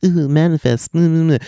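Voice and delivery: high mock TikTok manifesting; TikTok kids. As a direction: coo it like a kid casting a wish. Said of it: "I know the kids on TikTok".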